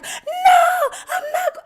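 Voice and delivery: very high, shrieked denial; hammed-up scream of refusal; panicked protest. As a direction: yell back in mock panic. Re-enacts panicked reply to yelled warning.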